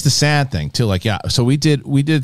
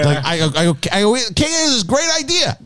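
much higher, laughing mock praise; mock excited praise; 11 semitones higher than usual. Telling friends how he praised his wife Kate, high mock gushing.

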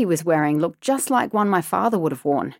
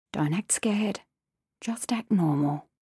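tense inner voice; 5 dB quieter than usual. A narrator's reading. Narrator's inner coaching in fear.

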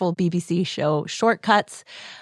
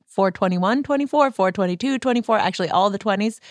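usual speaking voice

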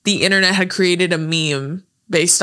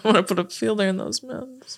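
quieter movie quote; 7 dB quieter than usual. Retold or imitated. Quoting a movie line again.

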